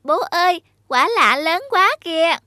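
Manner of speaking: put-on high, loud little child calling out; a small kid shouting with delight to dad; child's eager cry to father; excited child. Child calls father about fruit.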